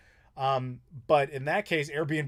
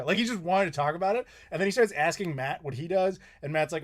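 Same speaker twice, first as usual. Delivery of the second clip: normal talking voice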